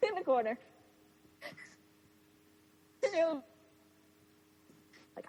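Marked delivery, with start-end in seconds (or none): none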